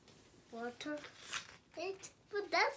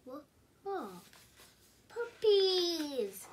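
ordinary delivery

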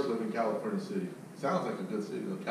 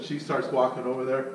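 ordinary talk, nothing marked

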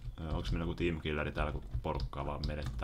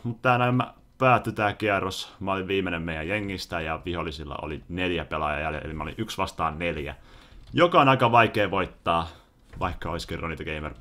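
usual speaking voice

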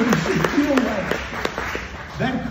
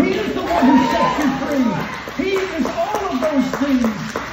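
preaching at full force; 4 semitones higher than usual. Acted. Pastor preaching the cross to his congregation, building fervor. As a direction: pile up each claim like a revival preacher.

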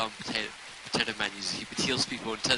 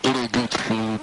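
radio call, louder; radio command voice; 7 dB louder, slower than usual. Pretend. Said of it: "sounds like you're giving radio commands".

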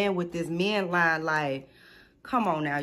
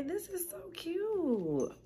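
cooing; 10 semitones higher, 6 dB quieter, much slower than usual. Real, not acted. Cooing over bottle.

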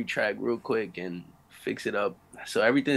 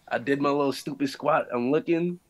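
ordinary talk, nothing marked

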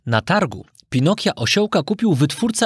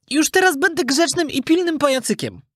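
very high puppet voice; promising puppet; 12 semitones higher than usual. A narrator's reading. Puppet promising the fairy to be good, earnest. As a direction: make an eager promise, pitched high up.

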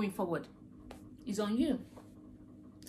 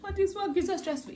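mock whining; 10 semitones higher than usual. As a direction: play the self-pitying overworker moaning. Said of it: "And then you come back".

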